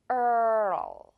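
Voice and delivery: 어 with the tongue rising to L; tongue lift demo. Shown as a demonstration. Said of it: "어 하면서 이 마지막에는 L 발음을". (혀를 들어 올리며 어)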